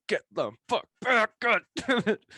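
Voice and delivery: yelling at a charging cow to back off; retold yell at cow. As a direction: shout the cow off, half laughing. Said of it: "I'm like".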